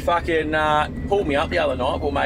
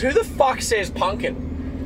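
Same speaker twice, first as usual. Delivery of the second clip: slow, raised, mock-incredulous question; incredulous girlfriend; 8 semitones higher, slower than usual. Quoting girlfriend mocking his word, slow and incredulous. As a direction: drag it out in disbelief. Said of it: "She goes".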